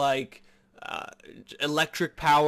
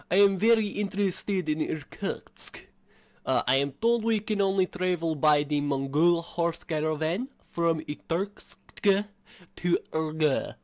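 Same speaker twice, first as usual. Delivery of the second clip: put-on French accent; 3 semitones higher than usual. Player asking about Irkutsk in a put-on French accent. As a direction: read the line in a hammed-up French accent. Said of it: "weirdest French accent I have".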